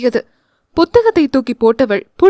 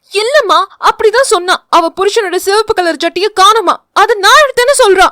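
high, loud, quickening complaint; heated accusation; 7 semitones higher, 5 dB louder than usual. Acted. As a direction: egg her mother on. Daughter accusing in a quarrel. (சண்டையில் உரத்த குற்றச்சாட்டு)

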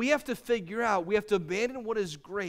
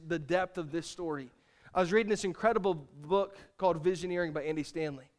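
usual speaking voice

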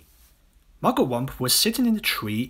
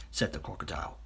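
plain reading voice

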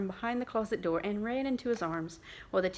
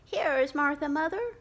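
put-on higher, louder children's cry; delighted little ones announcing Martha; excited children crying out; 7 semitones higher, 7 dB louder than usual. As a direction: shout it out like eager kids. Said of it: "cried the two young Cratchits".

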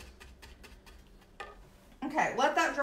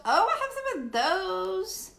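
excited; 9 semitones higher, 6 dB louder than usual. Real, not acted. Reacting to a viewer's comment.

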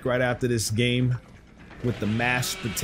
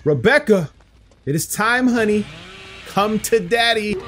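creepy taunt; 8 semitones higher than usual. Acted. Killer taunting a survivor creepily.

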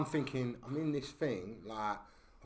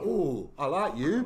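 higher, louder and slow, a hammed-up ooh of delight; teasing impression of a crook taking a shine; mock smitten boss; 6 semitones higher, 9 dB louder, slower than usual. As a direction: play the hard man suddenly charmed, drawn out. Boss warms to the narrator.

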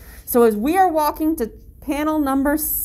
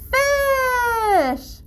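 excited reveal; 11 semitones higher than usual. Acted. Quiz reveal to children.

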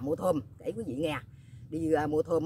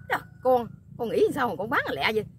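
raised, louder retold astonishment; disbelieving mother; 6 semitones higher, 5 dB louder than usual. Mother questioning daughter about the quick sale, astonished. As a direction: voice Mom's loud, high disbelief.

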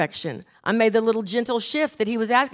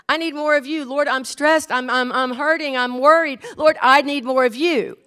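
raised, urgent plea to God, acted; pleading prayer; 4 semitones higher, 4 dB louder than usual. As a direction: plead with rising force as if stressed and hurting.

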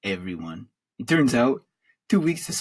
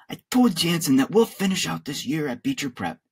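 ordinary delivery, nothing marked

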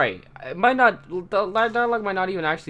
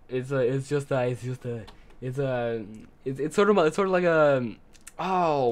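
ordinary talk

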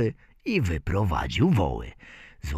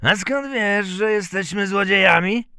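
raised, high-pitched challenge; startled thieves; 12 semitones higher, 5 dB louder than usual. A narrator's reading. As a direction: question the little stranger sharply, pitched up. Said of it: "Obejrzeli się złodzieje". (złodzieje, podniesionym głosem)

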